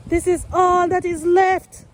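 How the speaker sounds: upset gardener showing chickens' damage, outraged; outraged dismay. Real, not acted. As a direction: cry out at the damage. Showing the ruined moringa plant.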